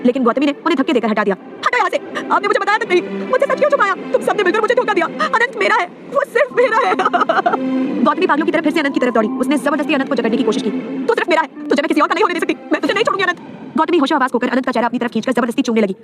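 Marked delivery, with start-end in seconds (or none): 1.7-6.9 high, wailing accusations; hysterical breakdown. A narrator's reading. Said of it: "भयानक चीख के साथ रोते हुए टूट पड़ी". 7.0-8.0 wordless crying gasps; hysterical sobbing. A narrator's reading. End of hysterical breakdown, wordless. 11.1-13.4 high, insistent declaration; obsessive, raised-pitch vow; possessive desperation. A narrator's reading. Obsessed woman clings to beloved.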